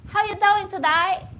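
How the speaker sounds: loud, high mock-Australian greeting; Australian accent. Comedian imitating an Australian greeting a friend, loud Australian accent. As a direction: hail a mate in exaggerated Australian. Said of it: "some Australian like".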